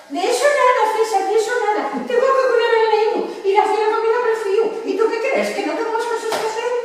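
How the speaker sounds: high-pitched nagging wife impression; mimicked wife. Wife telling César she has the child to mind, mock high scolding. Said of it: "Está muy bien".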